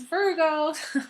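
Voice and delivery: real giggles breaking through; laughing while talking. Real, not acted. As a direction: crack up mid-sentence. Laughs mid-sentence.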